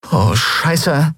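mildly alarmed swearing; dismayed oath. Acted. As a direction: let the curse out as danger dawns. Both women see danger, same oath.

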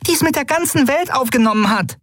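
very high plaintive cry; lamenting. Acted. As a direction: keep wailing the reproach. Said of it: "klagte sie".

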